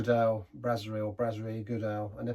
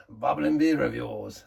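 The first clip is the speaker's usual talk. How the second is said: show announcer; 5 dB louder, slower than usual. Acted. Announcing show name grandly.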